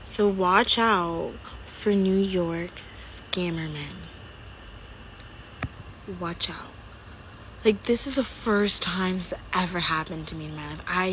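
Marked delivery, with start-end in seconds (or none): 0.1-6.7 hushed, slow whisper of warning. Real, not acted. Whispering a warning to viewers about scammer men. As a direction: lean in and breathe the warning. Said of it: "hushed warning to viewers".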